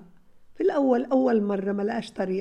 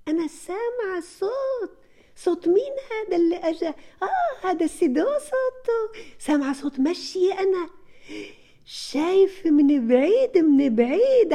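high, excited make-believe with laughter, growing louder; dramatic distraction; 8 semitones higher, 4 dB louder than usual. Shown as a demonstration. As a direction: pull the children's attention away with a big dramatic voice. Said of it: "تعملي دراما للتنين". (تمثيل درامي متحمس بصوت عالٍ لتشتيت الانتباه)

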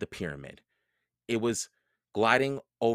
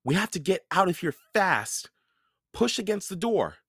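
put-on boy's voice, higher, hurried; urgent Jack; 10 semitones higher than usual. A narrator's reading. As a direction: get us out of here.

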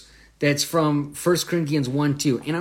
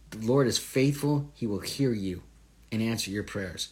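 usual speaking voice

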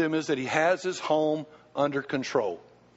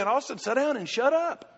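mock church rebuke; 8 semitones higher than usual. Church tells him to quit.

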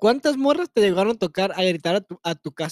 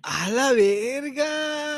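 drawn-out amazement; 5 semitones higher, much slower than usual. Real, not acted. Reacting to bragging.

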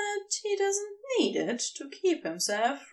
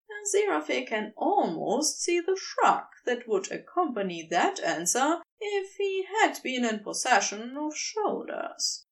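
plain reading voice

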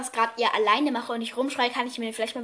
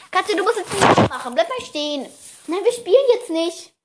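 higher, louder commands to the cat; scolding a pet; 6 semitones higher, 7 dB louder than usual. Real, not acted. Owner telling her cat to meow and stay, scolding playfully. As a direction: call out orders to a wayward pet.

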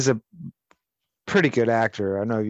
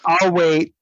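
loud, entitled I'll wait; mock Karen; 5 semitones higher, 8 dB louder than usual. Mocking a Karen demanding the police chief.

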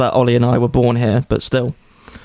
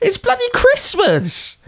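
mock excited.

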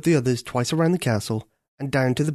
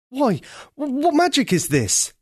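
high, stammering astonished prince; amazed cry; 13 semitones higher, 3 dB louder than usual. Acted. Prince amazed as the crow turns into a girl. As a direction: gasp out the question in wonder. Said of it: "he cried".

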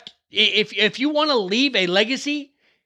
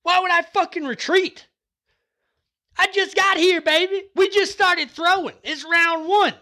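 fired-up yelling; 9 semitones higher than usual. Real, not acted. Vlogger hyping viewers never to retreat, fired-up yelling. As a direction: fire up the crowd like a fight-night hype man.

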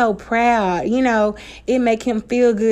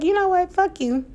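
higher, breezy kiss-off; dismissive brush-off; 9 semitones higher than usual. Retold or imitated. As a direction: flick him away lightly. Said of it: "I was just like".

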